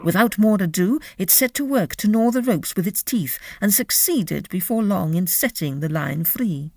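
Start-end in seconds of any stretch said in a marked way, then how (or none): none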